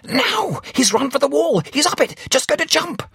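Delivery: very high, loud frantic cries; frantic shouting. A narrator's reading. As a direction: yell each line as the runner goes. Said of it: "ejaculated Captain Sender".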